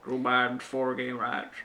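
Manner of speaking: slow put-on politician voice, actually a Bob Dole take; Joe Biden. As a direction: impersonate an American politician, slowly. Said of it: "I would just impersonate Joe Biden".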